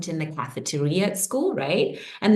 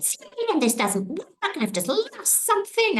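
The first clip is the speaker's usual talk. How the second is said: put-on upset teen daughter; 12 semitones higher than usual. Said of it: "And she was like".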